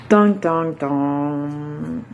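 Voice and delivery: drawn-out, falling "dong, dong, dong"; mock dramatic drumroll with the voice, last note held low; sung suspense sting. Host teasing listeners before the poem, mock suspense sting.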